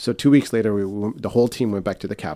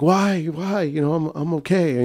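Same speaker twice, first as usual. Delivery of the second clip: higher, louder imitation of an upset plea; upset protest; 10 semitones higher, 6 dB louder, slower than usual. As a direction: plead in mock upset, pitched up high. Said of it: "And so he was upset".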